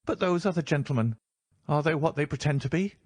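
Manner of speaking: a frightened man checking the strangers; nervous reedy man. A narrator's reading. Nervous patient voiced.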